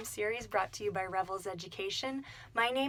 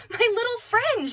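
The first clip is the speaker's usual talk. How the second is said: high, loud joyful cry; delighted exclamation; 12 semitones higher, 11 dB louder than usual. A narrator's reading. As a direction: greet the little snake with delight. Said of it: "she exclaimed".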